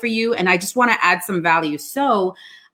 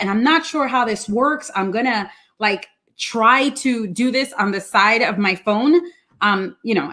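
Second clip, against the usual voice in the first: ordinary talk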